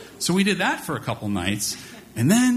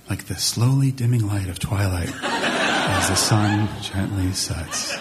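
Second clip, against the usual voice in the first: slow, low, lulling storybook read; sleepy bedtime voice; 7 semitones lower, slower than usual. Retold or imitated. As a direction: drag it out to put a toddler to sleep. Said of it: "I became audio Ambien".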